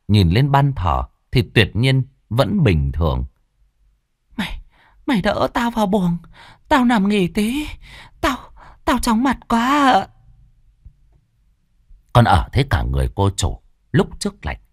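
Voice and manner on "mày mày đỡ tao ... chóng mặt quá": dizzy mistress. Acted. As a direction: weakly ask to be helped to bed.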